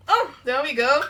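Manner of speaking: loud, relieved "there we go"; pleased burst as the lid gives; relieved triumph. Real, not acted. Jar finally opens.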